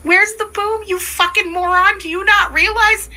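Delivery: high-pitched, exasperated challenge; exasperated berating. Real, not acted. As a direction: lay into him in disbelief. Berating the man in the clip.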